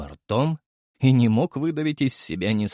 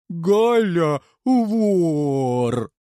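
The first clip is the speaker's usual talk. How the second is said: louder, drawn-out tattletale voice; drawling creature; 6 semitones higher, 5 dB louder, much slower than usual. Acted. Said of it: "протянул".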